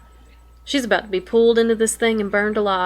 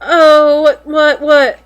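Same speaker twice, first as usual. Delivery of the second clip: louder, higher flustered whats; startled what; 4 semitones higher, 7 dB louder, much slower than usual. Real, not acted. Player caught off guard by the next image, flustered. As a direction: sputter in surprise.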